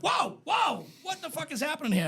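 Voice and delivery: high-pitched mock astonishment; startled crowd. Crowd hearing the comic's voice for the first time, astonished.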